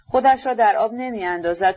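ordinary delivery

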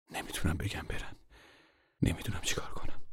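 soft, nearly whispered worry; hushed helpless mother. Acted. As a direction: murmur it to herself. Mother at a loss. (زمزمهٔ مادر درمانده)

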